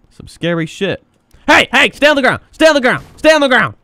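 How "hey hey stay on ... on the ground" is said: high, loud repeated order; shouted police command. Pretend. Cat officer ordering a suspect down, shouted command. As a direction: bark it like an arresting cop.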